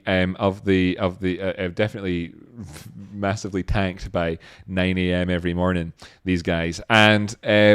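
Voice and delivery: ordinary talk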